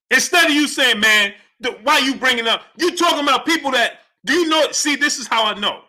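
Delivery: high-pitched, indignant argument; exasperated rant. Real, not acted. Host arguing back at chat, exasperated. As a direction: cut yourself off in frustration.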